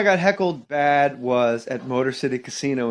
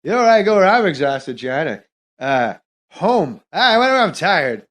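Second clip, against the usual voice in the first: Norm Macdonald. Acted. Comic retelling his heckled casino bit, rambling impression. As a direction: ramble awkwardly, stretching the key words. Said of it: "I did it in the impression".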